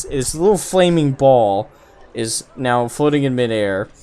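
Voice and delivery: normal talking voice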